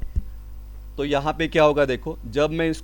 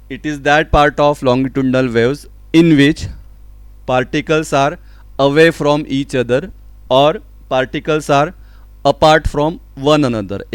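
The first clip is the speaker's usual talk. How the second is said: loud, slow, measured reading; emphatic textbook read-out; definition dictation; 6 dB louder, much slower than usual. Real, not acted. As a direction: dictate it to the class, phrase by phrase. Reads out a definition.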